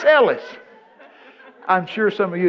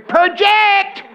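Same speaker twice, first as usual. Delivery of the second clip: much higher, louder shrill teacher yell; hammed-up teacher screaming project; mock teacher scream; 10 dB louder than usual. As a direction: bellow it like a tiny drama teacher from the corner. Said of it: "That's all I ever heard her screaming".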